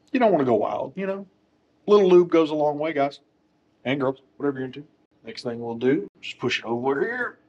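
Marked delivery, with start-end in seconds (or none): none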